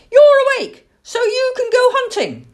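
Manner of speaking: put-on high, loud squeaky-child pestering; insistent owlet. A narrator's reading. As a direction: pester Dad loudly in a high little voice. Owlet insisting father is awake.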